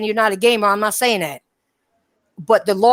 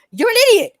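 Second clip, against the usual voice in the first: high, loud jab of disbelief; exasperated insult; 13 semitones higher, 4 dB louder, much faster than usual. Real, not acted. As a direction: snap it out, fed up with the commenter. Insulting a commenter on his timeline.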